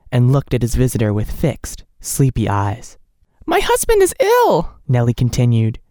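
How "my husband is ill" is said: high, tearful, barely held together; holding back sobs. A narrator's reading. Nellie pleading with the doctor, holding back sobs. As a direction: fight back the tears. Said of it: "restraining her sobs".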